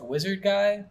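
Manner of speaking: normal talking voice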